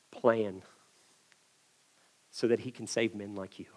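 calm authority, low and soft; hushed, low, assured address to captors; Jesus. Acted. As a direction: speak quietly from complete control. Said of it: "he was in complete control of the situation".

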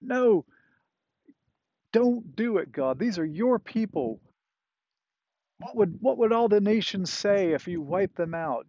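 high, impassioned argument; pleading Moses. Acted. Moses begging God to spare his people, impassioned plea.

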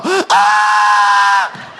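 short loud yelp of fright; girly scream. Retold or imitated. Pastor reenacting scream at palmetto bug, loud yelp. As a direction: shriek like a scared girl, comic. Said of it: "I screamed like a girl".

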